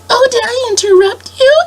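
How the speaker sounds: high sweet princess apology. Retold or imitated. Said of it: "she was like".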